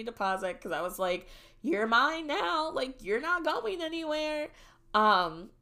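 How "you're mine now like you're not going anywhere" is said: playful possessiveness. Retold or imitated. Renter to new apartment after signing the lease, possessive. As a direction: stake your claim in a raised sing-song.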